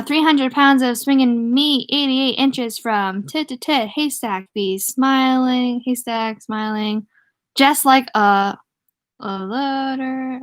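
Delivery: slow, drawn-out words as you work out the text; halting, careful sight-reading; decoding while reading. Real, not acted. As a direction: sound it out slowly, stretching each word. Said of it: "I have to decode as I'm going".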